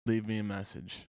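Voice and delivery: ominous voicemail. Retold or imitated. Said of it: "it was ominous".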